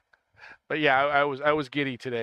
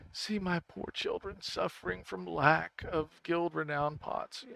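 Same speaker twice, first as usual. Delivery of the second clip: slow, softer pleading narration; mock charity appeal; 3 semitones higher, 6 dB quieter, slower than usual. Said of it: "have the [name] stuff in the background".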